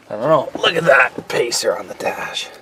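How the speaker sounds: soft unvoiced whisper. Real, not acted. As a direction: whisper it so nobody wakes. Wife sleeping, whispered aside.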